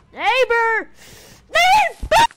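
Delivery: high, loud shout; calling out. Acted. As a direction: call across the house. Calling out to neighbor.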